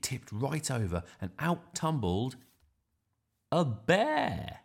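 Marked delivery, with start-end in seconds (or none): 3.5-4.6 high, loud storybook reveal; surprise reveal. A narrator's reading. Narrator revealing what tumbled out, high and dramatic. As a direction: punch it out as the big surprise.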